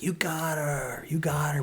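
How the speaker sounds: put-on high, drawn-out cheer of a kid; stretched, high-pitched retold cheer; excited child. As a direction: imitate a thrilled little boy. Son cheers the successful shot.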